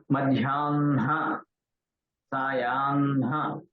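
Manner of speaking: madhyanha, sayanha drawn out, n held before ha; correct nasal-h order. Shown as a demonstration. Teacher models words with na and ha, carefully drawn out. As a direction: slowly say "madhyanha, sayanha", n flowing into ha. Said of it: "Na has to be pronounced like that".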